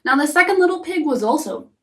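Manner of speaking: ordinary delivery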